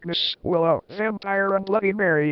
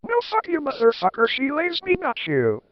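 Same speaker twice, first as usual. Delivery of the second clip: mock shout; 7 semitones higher than usual. A narrator's reading. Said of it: "shouted Vampire".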